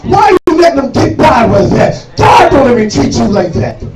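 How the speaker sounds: high, loud preacher's shout, each line hammered; full-force preaching. Acted. Preacher lifting the congregation, shouting high and loud. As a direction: raise the roof of the church with it.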